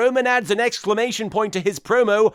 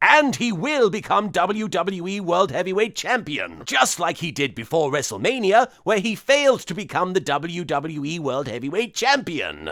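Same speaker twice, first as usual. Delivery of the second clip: normal talking voice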